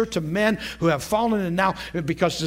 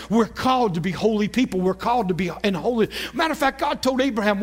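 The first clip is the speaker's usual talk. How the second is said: usual speaking voice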